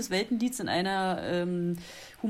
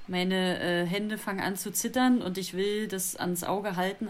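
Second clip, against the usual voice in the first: ordinary talk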